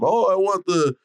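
put-on high, loud stab at a New England accent; badly faked New England accent; failed New England accent. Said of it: "I can't do a New England accent".

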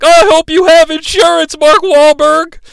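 high, stretched-out snotty whine of a line; snotty dude. Retold or imitated. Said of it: "this snotty dude".

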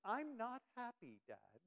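playful mock-angry complaint; Nathaniel. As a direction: imitate a son jokingly protesting to dad. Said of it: "he says it, like, in that voice".

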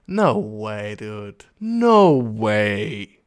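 tief und ungläubig. Real, not acted. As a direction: staunend abwinken. Reacts to listener's email.

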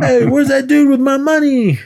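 high, loud imitation of an aggrieved guy; mock calling out. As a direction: yell after someone who ran off, played for laughs. Acted bystander calling out.

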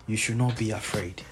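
ordinary talk, nothing marked

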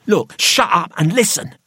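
loud, high, sharp order; sharp, impatient outburst; sharp command. A narrator's reading. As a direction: cut him off forcefully. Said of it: "said Gregory sharply".

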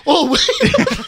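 loud, high mock alarm; hammed-up startled cry with laughter; French guy. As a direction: imitate a movie goon panicking. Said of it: "And the French guy's like".

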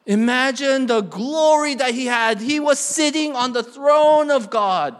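high, loud, soaring pulpit proclamation; ringing sermon climax; full-force preaching. Real, not acted. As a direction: proclaim the glory to the whole church.

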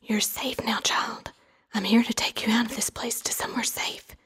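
calm voice. Acted. Aunt tells her niece she is safe now, calm whisper. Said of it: "in a calm voice said".

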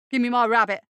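loud, obstinate shout; brash shouted demand. Acted. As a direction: yell it at the monster. Eddie demands his rabbit.

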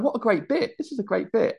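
high audience voice. Retold or imitated. Crowd voice thinking an argument is a bit. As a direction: play the delighted audience, up high.

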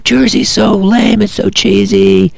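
put-on high, drawn-out kid whining; mocking a complaining kid; bitching kids. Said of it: "all the kids were bitching".

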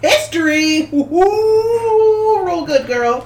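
high, loud whooping cheer; excited cheer. Real, not acted. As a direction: cheer a friend on. Cheering a friend's history roll.

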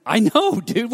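high-pitched "yes, I get it"; mock-exasperated reply to a friend; retold exasperated agreement. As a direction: agree impatiently, pitch jumping up. Acted impatient agreement with insistent friend.

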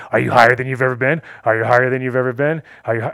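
loud, fast, repetitive badgering; intense in-your-face pestering. Arrogant old dealer badgering a smoker mid-hit, loud mimicked pestering. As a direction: fire the question insistently, mimicking a pushy man. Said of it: "he'd be in your face".

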